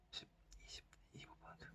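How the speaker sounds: fast whispered count under the breath. Real, not acted. Teacher counting to herself while drawing, in a whisper. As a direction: count the angles off in a quick whisper.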